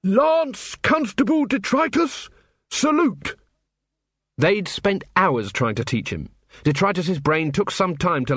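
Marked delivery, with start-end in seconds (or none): 0.0-3.4 high, drawn-out parade-ground bellow; furious officer bellowing an order at his troll constable. A narrator's reading. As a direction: bark the command across the street.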